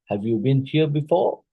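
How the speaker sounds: "have you been here before" ending on a clear upward question tune; rising intonation. Shown as a demonstration. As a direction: climb steadily to a high "before". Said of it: "this one is arising".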